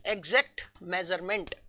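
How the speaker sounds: Exact measurement low and soft, one word at a time; measured dictation. Shown as a demonstration. Teacher dictating the first point to students, measured and low. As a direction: say exact, pause, then measurement, quietly.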